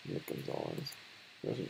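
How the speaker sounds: soft unvoiced whisper of the name; whispered mutter. Real, not acted. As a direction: breathe the name under your breath.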